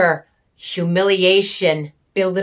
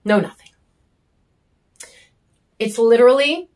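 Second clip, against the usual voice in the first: ordinary delivery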